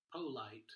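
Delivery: polite said wrongly as POH-lite; long O in polite. Shown as a demonstration. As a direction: give polite a full, stressed long O. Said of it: "We don't say".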